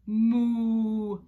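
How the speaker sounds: long, fairly loud moo; cow moo. Shown as a demonstration. Teacher shows her toy cow, long moo.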